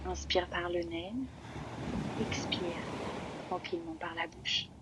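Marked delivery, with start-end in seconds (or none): none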